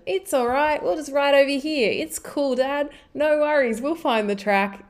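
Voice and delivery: put-on breezy, no-worries boy reassuring his dad; carefree youngest son.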